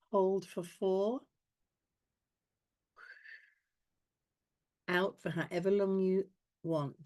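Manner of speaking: calm, paced breath count. Acted. Leads breathing exercise.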